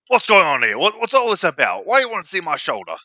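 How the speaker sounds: lightly voiced angry brute; barbarian voice. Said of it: "Oh, what a barbarian voice".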